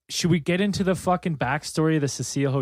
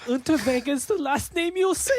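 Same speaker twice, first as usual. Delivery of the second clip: mock German threat; 11 semitones higher than usual.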